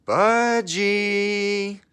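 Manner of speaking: high, loud, drawn-out tannoy call; hammed-up loudspeaker paging Budgie, high and long. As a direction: boom it out like a public address system. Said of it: "it wouldn't have been a loudspeaker if it had whispered".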